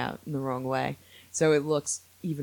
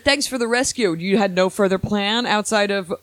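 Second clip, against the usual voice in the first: loud, higher, incredulous complaint; mock-indignant at a bungled rescue; sassy telling-off of the rescuer; ornery Princess Leia; 6 semitones higher, 8 dB louder than usual. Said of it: "ornery when she's rescued".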